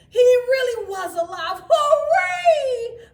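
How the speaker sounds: very high, loud shout; joyful cheer. A narrator's reading. Children cheering that Frosty is alive, shouting. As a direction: scream with delight. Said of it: "they cheered".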